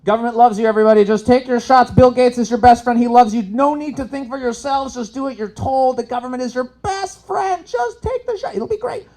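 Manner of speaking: high, sugary mock reassurance; sarcastic. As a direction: sell it like a fake government ad. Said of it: "And I'm just being sarcastic".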